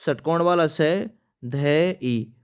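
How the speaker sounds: very slow letter by letter; slow spelling out. Shown as a demonstration. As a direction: dictate pe re mein o slowly. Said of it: "परमौषधि ऐसे लिखते हैं". (धीरे-धीरे वर्तनी बताते हुए)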